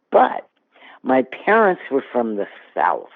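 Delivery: usual speaking voice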